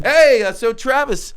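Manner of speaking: nervous opener. Retold or imitated.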